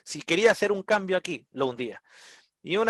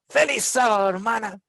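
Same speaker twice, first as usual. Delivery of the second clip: cheery greeting; 7 semitones higher than usual. Retold or imitated. Greets the hostile lady cheerfully.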